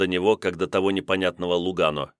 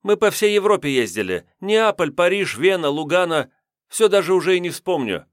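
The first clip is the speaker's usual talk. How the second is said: put-on chatty young woman; 10 semitones higher, 4 dB louder than usual. A narrator's reading. Redhead voiced, high.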